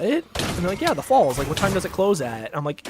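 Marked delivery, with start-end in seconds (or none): 0.8-2.5 higher, louder imitation of a clueless tourist; mock customer, pitched up and insistent. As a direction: push the question as if it's obvious. Acted customer insisting on silly question.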